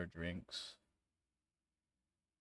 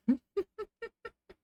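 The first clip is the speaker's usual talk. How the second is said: high, loud maniac cackle; acted manic laughter; maniacal laugh; 8 dB louder than usual. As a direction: cackle like a madman. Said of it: "laugh like a maniac".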